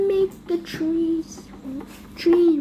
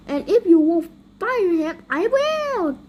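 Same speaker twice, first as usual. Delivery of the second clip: angry threat; 4 dB louder than usual. Acted. Mayor threatening to fire Dogman himself, angry.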